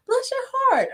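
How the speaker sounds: very high-pitched mock sweetness; different accent and meaning. Shown as a demonstration. Showing it depends on who's saying it, high-pitched take. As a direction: squeal it way up high. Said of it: "depends on who's saying it and what accent".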